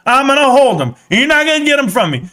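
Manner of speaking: normal talking voice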